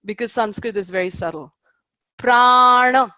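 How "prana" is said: "Prana" loud and clear with a long a; teacher's call-and-repeat model; modeled pronunciation. Shown as a demonstration. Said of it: "Can everybody say that?".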